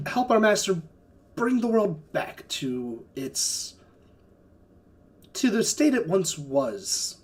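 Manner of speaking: slow, solemn messenger; halting herald with weighty pauses; formal envoy. Acted. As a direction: deliver a measured, grand plea. Envoy delivers master's plea.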